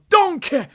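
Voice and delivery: loud high braying shout of Donkey; mock donkey bray. Kid shooting and making the donkey noise for Saka, a loud bray. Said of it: "You might have to do the donkey noise".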